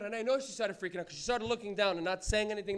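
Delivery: normal talking voice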